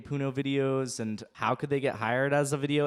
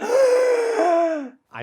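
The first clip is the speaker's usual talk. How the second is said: dazed voice; 13 dB louder than usual. Pretend. Mimicking zombie scrolling trance.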